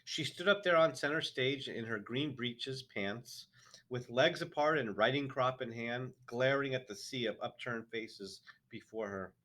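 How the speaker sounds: usual speaking voice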